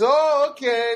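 high, loud mock accent; imitated thick-accented phone agent; Luna, thick accent. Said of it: "very thick accent".

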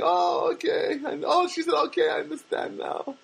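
put-on very high girlish realization; mimicked Armenian girl. Girl understanding his explanation, high mimicked voice. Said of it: "she talks like this".

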